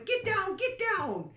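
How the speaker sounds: high mock yell; retold yell. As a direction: squeal it like a panicked teacher. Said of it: "she's yelling at me".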